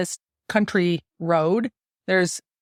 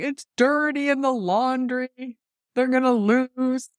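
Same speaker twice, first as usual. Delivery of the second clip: higher, slow mock-despairing wail; mock panic; 6 semitones higher, slower than usual. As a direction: play up the panic over the lost lucky shirt. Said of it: "someone would, like, get".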